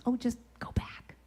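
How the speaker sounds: whispered like a nudge from within; soft whispered shoulder tap; inner voice whisper. Retold or imitated. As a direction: breathe it as a quiet inner prompting. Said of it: "It's that little intuitive voice".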